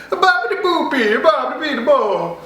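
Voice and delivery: high, laughing mock Italian babble; mock Italian gibberish. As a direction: toss off nonsense in a silly Italian accent.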